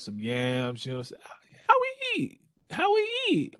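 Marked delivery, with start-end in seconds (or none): 1.7-3.5 high squeaky voice. Acted. Joking about soul food.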